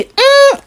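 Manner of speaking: high-pitched hungry hum; craving "hum". Retold or imitated. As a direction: hum with longing for the food. Voices her craving for the dish.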